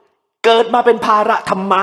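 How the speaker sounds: put-on boiling drunken father berating his baby; furious drunk yell. A narrator's reading. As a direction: spit the words at the child. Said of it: "อารมณ์เดือดปุดปุด".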